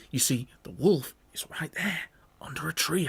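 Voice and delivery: hushed unvoiced whisper. Billy telling Mother the wolf is asleep, whispering. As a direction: whisper so the wolf won't wake.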